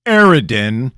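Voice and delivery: loud, high outraged cry; king hearing of the attack, shouting; shouted. A narrator's reading. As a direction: bellow the name in fury. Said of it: "the king shouted".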